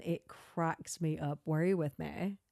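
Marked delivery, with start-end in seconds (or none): 1.4-2.4 put-on Valley Girl accent. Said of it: "her little Valley Girl, like, accent".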